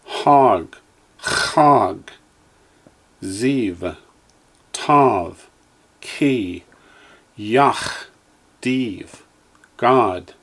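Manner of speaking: each syllable said slowly and clearly, with a pause after it; teacher's careful drill voice; Hebrew syllables for echoing. Shown as a demonstration. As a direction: model every Hebrew sound for the class to repeat. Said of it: "Line five".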